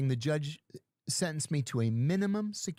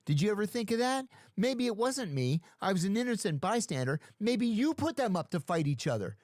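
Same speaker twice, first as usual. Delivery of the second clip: cocky accusation; 7 semitones higher than usual. Retold or imitated. Counter-accusing the lieutenant.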